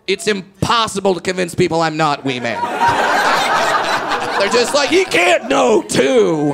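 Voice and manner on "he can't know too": louder mock insistence, hammed up; mock insistent shout. Strangers loudly insisting he must be Wee Man. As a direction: shout it like a stubborn fan.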